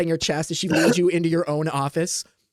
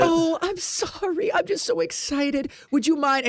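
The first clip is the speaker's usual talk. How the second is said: high, slow, sugary pleading; cutesy excited voice; 7 semitones higher than usual. Acted. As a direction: gush like an overeager friend. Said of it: "I can never take you seriously when you do this".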